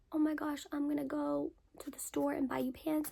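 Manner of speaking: mom's hushed whisper. Retold or imitated. Mom whispering to her daughter in the waiting room. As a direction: breathe it out as a secret aside. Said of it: "my mom's like".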